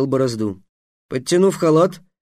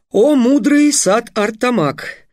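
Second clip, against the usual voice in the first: slow, high, flowery flattery; flattering young mage; 9 semitones higher, 4 dB louder, slower than usual. Acted. Trix flatters the official.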